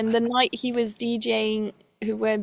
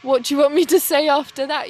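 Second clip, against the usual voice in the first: a fast, higher, louder stunned question; retelling her stunned reply; shocked retort; 4 semitones higher, 5 dB louder, faster than usual. Retold or imitated. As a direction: fire it back in shock. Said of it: "I honestly was so shocked".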